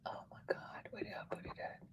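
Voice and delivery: barely audible, voiceless whisper; whispering to self. Real, not acted. Reader searching the iPad for the letter, whispered. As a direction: whisper it only to yourself.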